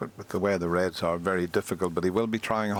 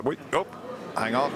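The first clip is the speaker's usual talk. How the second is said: sudden surprise; 6 semitones higher than usual. Real, not acted. Ball nearly drops, commentator reacts.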